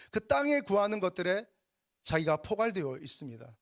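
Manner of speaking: high-pitched, driving sermon delivery; fervent preaching. Real, not acted. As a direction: press each phrase on the congregation. Preaches with rising fervour.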